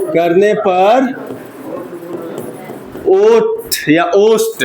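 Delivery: slow, spaced-out dictation with long pauses. Shown as a demonstration. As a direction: dictate word by word for students to write. Teacher dictates a definition. (धीमे-धीमे रुक-रुक कर लिखवाना)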